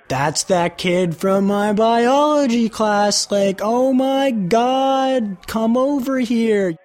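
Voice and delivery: high, slow, stretched-out party holler; imitated excited calling. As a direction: mimic an amazed kid calling someone over. Acting out partygoers spotting someone.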